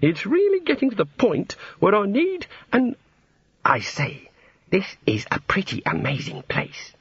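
high-pitched god, dropping as he gets distracted. A narrator's reading. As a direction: start high, then drift off into wonder.

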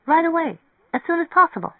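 put-on high, urgent young woman's voice; eager. A narrator's reading. Mary Ann wants to leave right away, high and eager. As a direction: pitch it up, keen to get going.

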